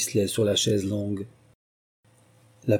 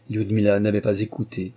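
plain reading voice

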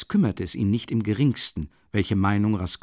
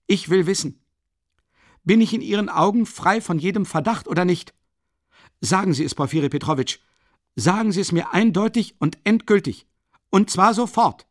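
higher, louder insistent demand building; emphatic demand; 8 semitones higher, 4 dB louder than usual. Acted. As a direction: press him for an answer now. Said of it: "laut und nachdrücklich".

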